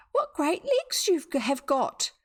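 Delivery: put-on little girl voice. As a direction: sound small and amazed. Retake of girl's line.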